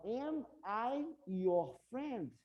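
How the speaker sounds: very slow question, "am" stressed; slow model question. Shown as a demonstration. Teacher slowly models the question to students. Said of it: "Aí eu quero perguntar".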